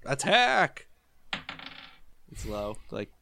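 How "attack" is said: high called-out battle cry. Acted. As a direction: call the attack out high and punchy. Calls out an attack.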